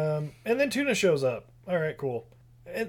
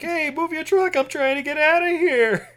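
high-pitched mock holler at a driver; annoyed yelling; 11 semitones higher, 4 dB louder than usual. Blocked-in man yelling at a truck driver, mock annoyed holler. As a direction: call out in exaggerated impatience, pitched way up. Ice cream man's blocked-in quote.

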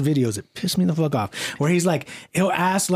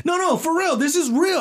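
much higher, squeaky hammed-up insistence; mock YouTuber, insisting; 12 semitones higher than usual. Mocking a YouTuber insisting to a stranger it's real, very high. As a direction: push the fake sincerity way up high. Imitating Mister Beast insisting.

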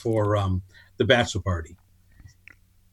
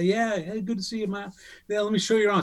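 high, easygoing imitation of a buddy; breezy, welcoming host voice; friendly Chris; 9 semitones higher than usual.

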